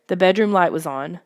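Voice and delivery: plain reading voice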